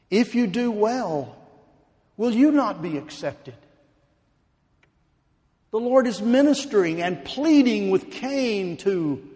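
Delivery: high, loud pleading sermon; impassioned preaching. Acted. Preacher pleading with Cain, at full force.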